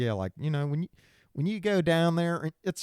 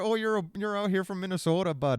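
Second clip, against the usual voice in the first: high, lilting mock Minnesotan; hammed-up Minnesota voice; Minnesotan accent; 6 semitones higher than usual. Shown as a demonstration. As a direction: parody a chatty Minnesotan accent. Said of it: "That's like the Minnesotan".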